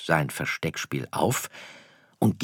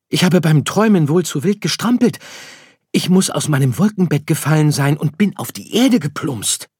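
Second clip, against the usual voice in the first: high, loud little-star voice; dismayed little star; 10 semitones higher, 8 dB louder than usual. Acted. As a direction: excitedly work out the mishap, pitched high. Story figure Sirius realizes. (aufgeregter, bestürzter kleiner Stern)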